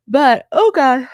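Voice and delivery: words stretched by a yawn. Real, not acted. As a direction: talk through a big yawn. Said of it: "I'm yawning".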